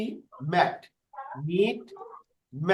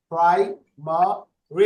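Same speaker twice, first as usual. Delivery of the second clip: slow "primary" in three beats; three separate syllables; much slower than usual. Shown as a demonstration. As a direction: count out pri, ma, ri with gaps. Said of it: "কিভাবে চার syllable?".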